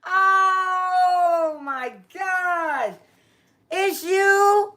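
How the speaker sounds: thrilled shriek of recognition; delighted surprise. Real, not acted. Host spots a longtime live viewer in the chat, squealing with surprise.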